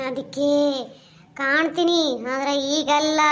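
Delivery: a lightly voiced menacing ghost. Ghost replies.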